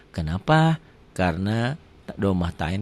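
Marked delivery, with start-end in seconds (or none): none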